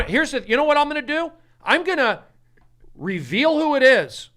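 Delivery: worked-up, exasperated declaration; fed-up announcement. Real, not acted. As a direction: announce it emphatically, spacing out the phrases. Fed-up host announces a decision.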